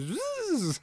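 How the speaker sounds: high buzzing "zzz" of the spinner; imitated buzz. Vape spinner sound, high buzzing imitation. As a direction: buzz like a tiny motor. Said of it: "when you vaped it, it goes".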